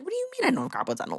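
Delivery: imitating her own angry disbelief; retold outrage. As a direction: fire it back, offended and quick. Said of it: "Like, I was mad".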